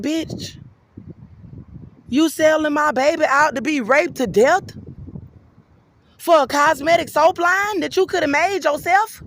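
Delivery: high, loud indignant accusation; outraged rebuke. Retold or imitated. Speaker confronting Larissa over selling the baby, outraged. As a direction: confront her, voice pitched right up.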